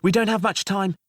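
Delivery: high, loud call. Acted. Jailer calling down to the cell, loud and high. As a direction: call down urgently.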